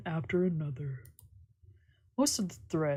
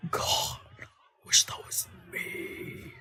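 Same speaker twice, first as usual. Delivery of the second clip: slow mock whisper; much slower than usual. Reading a creepy forum comment as a slow whisper. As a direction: breathe it out like a creepy aside.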